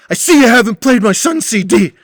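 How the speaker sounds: put-on voice of a sulky old man; snide Butch impression. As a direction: mimic the grumpy regular, accusing. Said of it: "he just goes".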